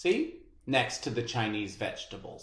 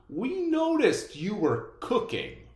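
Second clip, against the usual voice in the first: laughing neighbor; 7 semitones higher than usual. Acted. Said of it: "Mister Fitzgerald laughed".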